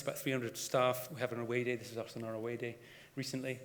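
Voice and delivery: normal talking voice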